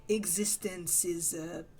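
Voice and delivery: slow, heavy put-on European accent. Streamer fooling around with accents mid-game, slow hammy European accent. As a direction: stretch it out in a mock European accent. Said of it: "Stop doing accents".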